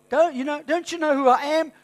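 loud, high, haughty mimicry of pride; arrogant mock. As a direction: preach it as a puffed-up boaster, loud and high. Mocking proud voice.

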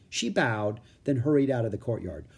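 plain reading voice

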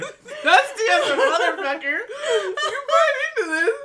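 talking through laughter; giggling while describing; laughing. Real, not acted.

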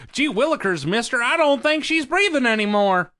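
put-on high, loud golly-gee kid voice; wholesome 1950s kid. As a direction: play an innocent old-timey boy.

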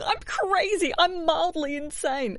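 high, put-on mimicry of "I'm crazy, I'm mildly insane", slow on "mildly insane"; mocking quirky voice. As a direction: parody the people who call themselves quirky. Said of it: "I hate people going".

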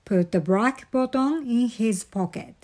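usual speaking voice

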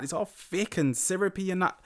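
ordinary talk, nothing marked

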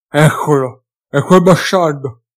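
loud, slowed hiss through broken teeth; furious hiss through broken teeth. A narrator's reading. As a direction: spit it out in hatred. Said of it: "sibilando tra i denti rotti".

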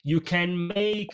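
normal talking voice

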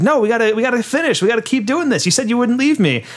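high, pleading mock voice; mock-distressed actor pleading with his director; imitated desperate plea. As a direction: pitch way up, beg them to stay. Nick begs director not to leave.

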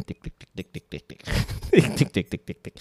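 whispered tick tick typing; typing sound. Pretend. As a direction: breathe the ticks out like keystrokes. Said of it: "I'm gonna type again".

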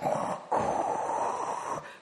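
long breathy sucking sound; imitated sucking noise. Comic showing how she sucked on the dab, noisy inhale. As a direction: suck air noisily without voice. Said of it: "I was just like".

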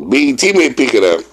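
high, fast, giddy repeat of the joke; amused high repeat. Retold or imitated. Repeating his own joke about the network, high and giddy. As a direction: toss it off again with a grin. Repeats BET line laughing, pitched up.

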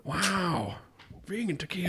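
soft, high, awed actor impression; Owen Wilson. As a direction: marvel quietly in a high put-on voice. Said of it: "I'll be Owen Wilson".

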